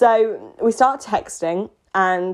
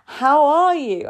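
high, slow tipsy gush at a crush; drunk gushing; 6 semitones higher, much slower than usual. Retold or imitated. Drunk girl greeting her old crush, high and slow.

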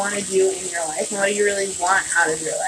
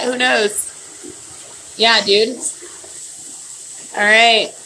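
ordinary talk, nothing marked

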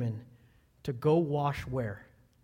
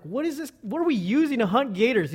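higher-pitched, incredulous questioning; retold bewilderment; 6 semitones higher, 5 dB louder than usual. Pastor on a gator hunt asking about gear, incredulous. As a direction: question it in mock alarm.

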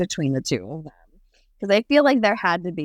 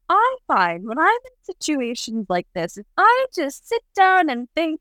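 higher, slow, airy calm dad voice; lofty dad; 9 semitones higher than usual. Retold or imitated. Dad explaining to Indiana why he sits down, airy mock calm. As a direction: put on the unbothered father. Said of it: "And his dad's like".

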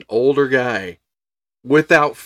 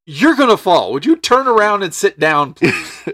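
exasperated plea; 7 semitones higher, 4 dB louder than usual. Retold or imitated. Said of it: "I'm like".

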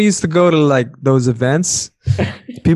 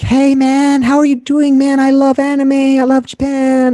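mock newcomer, much higher and louder; high eager anime fan; 12 semitones higher, 3 dB louder than usual. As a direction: squeal it like an overeager anime fan, slowing at the end. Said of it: "They're like".

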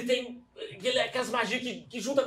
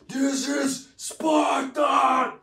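slow, drawn-out roar, higher and louder; battle cry shout; 5 semitones higher, 6 dB louder, much slower than usual. Pretend. Player's warrior roars a war cry at the druid. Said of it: "Já olho pro cara e falo".

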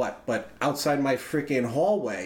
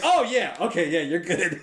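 laughing reply; 4 semitones higher than usual. Retold or imitated. Turning to the strangers, laughing.

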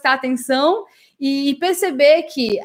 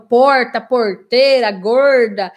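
caipira accent, rolled-back R in porta, porteira, gorda; louder, curled interior-of-Brazil R; Brazilian countryside R; 4 dB louder, much slower than usual. Shown as a demonstration. Teacher illustrates the R, Brazilian countryside accent. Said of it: "aquele R que a gente fala de interior".

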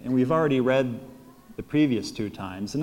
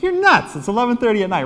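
high, loud disbelief; retold incredulous objection, pitched up; incredulous protest; 9 semitones higher, 5 dB louder, faster than usual. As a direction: balk at a crazy plan. Acted protest at friend's late-night plan.

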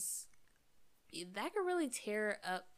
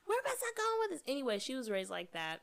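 tipsy, giggling between words; 6 dB louder than usual. Real, not acted. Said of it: "I'm a little drunk".

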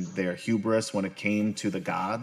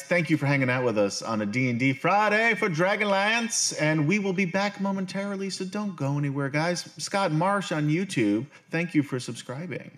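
bright raised announcer voice; cheery stream host talking to viewers; host sign-off; 8 semitones higher than usual. Acted. Streamer thanking viewers in a bright announcer voice.

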